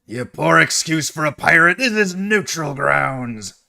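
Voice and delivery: loud, high yell hurled across the room; outraged pirate shout, drawn out at the end; yelling back. Acted. Game master's pirate yells back at the attacker, loud outraged yell. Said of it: "he yells back and says".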